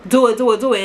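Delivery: low-pitched, sly urging on; fake egging on. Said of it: "she probably was fake".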